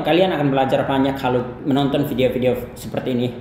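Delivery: ordinary delivery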